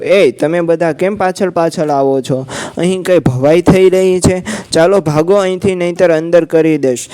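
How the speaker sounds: ordinary delivery, nothing marked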